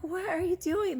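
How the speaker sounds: high-pitched mock parental disbelief; retold exasperation. Parent reacts to the little one's prank, retold exasperation.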